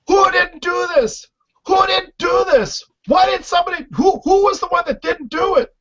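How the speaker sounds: hammed-up furious shouting; mock angry yell. Houseguest mimics Andrew yelling about dirty dishes, shouting high.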